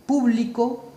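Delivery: público with PÚ held and firm; stress on first syllable. Shown as a demonstration. Teacher contrasting examples, público stressed on PÚ. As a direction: lean on PÚ in público. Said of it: "dependiendo dónde lleva el acento".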